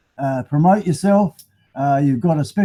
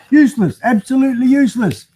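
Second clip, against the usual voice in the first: higher, louder fed-up outburst; exasperated; 7 semitones higher, 4 dB louder than usual. Real, not acted. Fed-up vlogger tossing a useless magnifier, louder and higher. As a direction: vent at the useless gadget.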